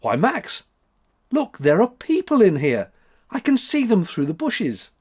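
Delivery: high, eager gasp of discovery; astonished little girl crying out; astonished exclaim. A narrator's reading. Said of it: "exclaimed in an astonished voice".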